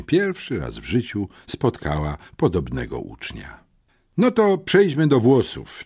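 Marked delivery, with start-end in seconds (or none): 4.2-5.8 przejęty uczeń. A narrator's reading. Boy resumes his report.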